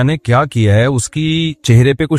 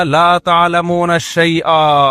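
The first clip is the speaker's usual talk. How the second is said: Quran recitation; 3 semitones higher, much slower than usual. Shown as a demonstration. Quran verse quoted in sermon.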